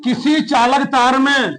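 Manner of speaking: measured classroom dictation, phrase by phrase; slow dictation. Real, not acted. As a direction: dictate slowly with long pauses for writing. (धीरे-धीरे रुक-रुककर इमला बोलते हुए)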